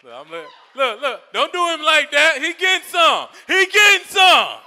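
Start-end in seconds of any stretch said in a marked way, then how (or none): 0.7-3.3 higher, louder, laughing yells; excited shout. Real, not acted. Comic defends guy to the crowd. 3.5-4.6 GETTING drawn out, higher and louder; louder, slower shout. Real, not acted.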